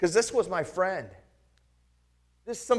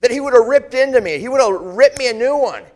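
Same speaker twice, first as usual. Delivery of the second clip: louder and higher as the rebuke is imagined; building indignation; 7 semitones higher, 10 dB louder than usual. Acted. Picturing Jesus tearing into him, rising louder and angrier. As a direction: let the anger climb.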